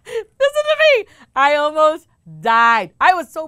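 squealed through laughter, high and loud; real laughter carrying the words; laughing. Real, not acted. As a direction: still cracking up, words squeezed out. Laughing at the punchline.

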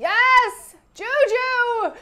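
high, loud cheering scream; screaming fans. Retold or imitated. Re-enacting viewing parties for listeners, screaming. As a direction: shriek it like a viewing party crowd. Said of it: "us just screaming".

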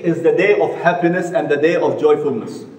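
ordinary talk

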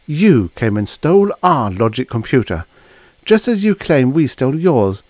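high-pitched, emphatic counter-charge; accusing. A narrator's reading. She counters with her own accusation.

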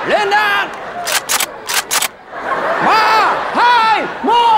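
barked military commands, high and loud; drill sergeant. Pretend. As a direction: shout orders like a firing squad officer. Mock firing squad commands.

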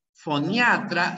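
Foniatra said slowly with the A hit hard; stressed on the A. Shown as a demonstration. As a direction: lean on the A in Foniatra, fo-nia-TRA. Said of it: "la fuerza de voz está en la A".

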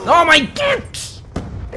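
long high wailing oh my god; slow, high shocked outcry; drawn-out shocked cry. Real, not acted. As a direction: stretch it out in disbelief. Dies in game, outcry.

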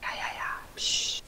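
unvoiced hiding whisper; whispering while hiding. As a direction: whisper so nobody finds you. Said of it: "Ganz leise".

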